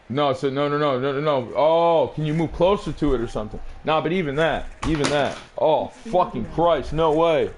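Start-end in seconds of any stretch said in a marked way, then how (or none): none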